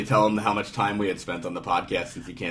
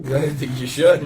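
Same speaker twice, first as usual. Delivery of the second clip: laughing; 5 semitones higher, 7 dB louder, slower than usual. Real, not acted. Joking reply with a laugh.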